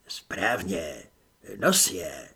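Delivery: low, hoarse voice through a wooden wall; hoarse hidden voice. Acted. Mysterious voice behind the wall, lightly hoarse and low. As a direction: rasp it out from hiding, a few tones down. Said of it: "chraptivě".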